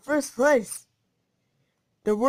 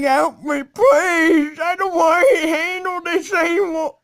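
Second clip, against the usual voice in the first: mock pleading victim; 6 semitones higher, 7 dB louder than usual. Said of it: "He's like".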